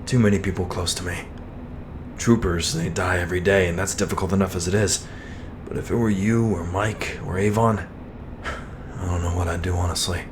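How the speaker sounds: understated sorrow, voice sinking at the end; a touch of grief, held in; subdued confession. Acted. Friend confesses fear of further loss.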